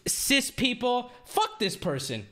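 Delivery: high, stretched outraged whines; incredulous fury, dragging the words out; furious disbelief. Real, not acted. Streamer fuming at the clip, high stretched outrage. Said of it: "I'm so mad".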